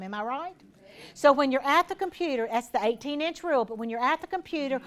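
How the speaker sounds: ordinary talk, nothing marked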